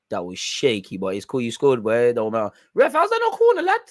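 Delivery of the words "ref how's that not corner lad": squealing protest much higher and louder; high outraged yell. Real, not acted. Fan protesting a corner decision to the ref, high outraged yell. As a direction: shriek at the ref in disbelief. Screaming at referee on TV.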